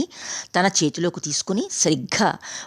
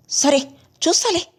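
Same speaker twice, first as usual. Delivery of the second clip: hurt anger; 11 semitones higher than usual. A narrator's reading. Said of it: "కోపంతో, అభిమానంతో".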